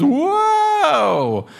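very high startled cry; startled whoa. Acted. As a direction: jump back surprised. Mom Cat startled by kittens.

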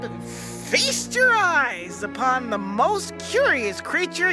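slow, grand showman announcement; carnival barker. Pretend. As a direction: build suspense like a sideshow host. Barker presenting a curious creature.

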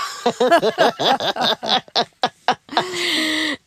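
long high bursts of laughter; real laugh. Real, not acted. Laughing to the interviewer at her own story. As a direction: laugh it off, tickled.

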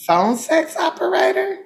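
high, hesitant guess; sheepish question. Real, not acted. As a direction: ask it like an awkward confession. Unsure confession.

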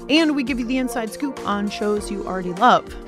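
ordinary talk, nothing marked

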